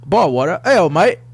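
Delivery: high, loud mock British; British accent attempt. Shown as a demonstration. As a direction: copy a British accent, hammed up. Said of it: "how would you say it".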